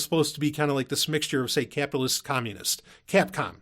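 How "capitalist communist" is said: normal talking voice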